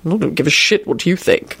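unmoved, offhand dismissal; cool, level indifference; impassive. A narrator's reading. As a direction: dismiss it without any feeling. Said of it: "Quentin replied impassively".

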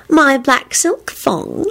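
high, slow, teasing voice; coy. A narrator's reading. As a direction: draw it out coyly for your lover. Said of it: "she replied coyly".

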